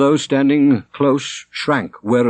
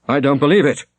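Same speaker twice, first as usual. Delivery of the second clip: put-on vehement young man; 5 semitones higher, 3 dB louder, faster than usual. A narrator's reading. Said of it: "fine, clear, vehement voice".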